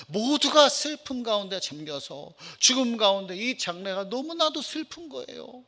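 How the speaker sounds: normal talking voice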